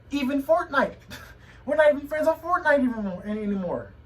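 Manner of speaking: incredulous high laugh-rant; exasperated outburst. Real, not acted. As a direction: voice jumps high and loud in disbelief. Upset rant about being blocked.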